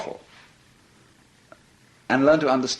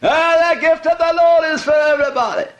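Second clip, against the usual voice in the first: revivalist preacher; 7 dB louder than usual. Retold or imitated. Imitating a revivalist preacher on the radio, loud and high. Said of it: "he was saying".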